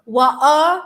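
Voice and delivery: loud mock-Cockney glottal stop; Cockney accent attempt. Streamer copies partner's example, loud Cockney attempt. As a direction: punch out "What, uh" as Cockney "wa'er". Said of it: "Cockney accent".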